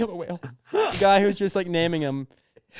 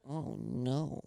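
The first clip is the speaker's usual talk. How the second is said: a lightly voiced, hushed creepy moan; a hint of a guilty, uncomfortable whimper; a faint, uncomfortable little moan; 5 semitones lower, 16 dB quieter than usual. Shown as a demonstration. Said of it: "a voice that I do. It's a really uncomfortable voice".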